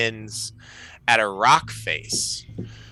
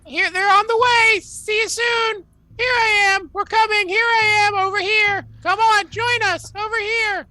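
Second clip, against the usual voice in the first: very high, loud squawking chatter; crow voice; 5 dB louder than usual. Acted. Crows babble replies to Skywatcher, high loud squawking. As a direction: squawk out overlapping bird cries. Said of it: "And they say".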